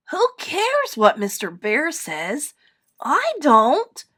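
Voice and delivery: high, loud little bear defiance; rising to a loud, high boast; defiant cry. A narrator's reading. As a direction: cry it out cheekily. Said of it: "cried Little Bear".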